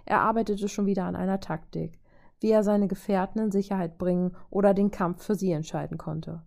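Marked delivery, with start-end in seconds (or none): none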